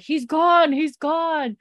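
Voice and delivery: high, loud, urgent calling out; mock alarmed shout. Recapping a man who comes running with news, acted alarm. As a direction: imitate a messenger raising the alarm. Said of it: "one of the Browns guys coming running".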